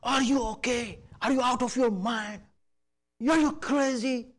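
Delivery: high, loud furious son yelling at his father; angry outburst. Retold or imitated. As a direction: fling it at the father in a rage. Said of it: "with so much anger".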